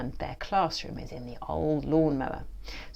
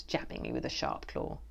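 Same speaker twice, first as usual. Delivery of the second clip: ordinary delivery, nothing marked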